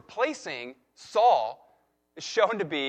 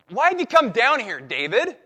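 sharp, raised and louder, a mock scold; mad older brother; 5 semitones higher, 7 dB louder than usual. Older brother scolding David, raised and louder. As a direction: snap at the little brother in a higher voice. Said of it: "he gets mad at him".

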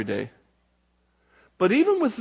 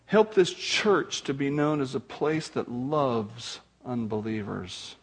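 closing prayer; 5 semitones lower than usual. Acted.

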